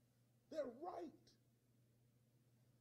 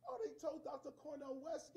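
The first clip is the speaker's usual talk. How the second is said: ordinary delivery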